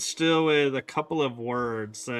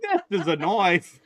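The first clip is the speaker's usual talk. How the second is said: Crocodile Dundee quote; 4 semitones higher than usual. Retold or imitated. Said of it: "I sounded like Paul Hogan".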